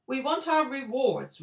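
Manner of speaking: high, very loud roar; lion roaring his demand at the wizard. A narrator's reading. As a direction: bellow it. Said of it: "roared the lion".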